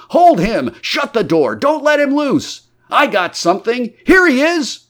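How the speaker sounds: high, loud, urgent cries; frantic crowd shouting. A narrator's reading. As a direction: shout orders in a chaotic chase. Crowd chases the invisible man.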